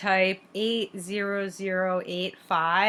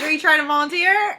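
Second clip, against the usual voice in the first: mock whiny retort; 6 semitones higher, 6 dB louder than usual. Joking retort to harasser.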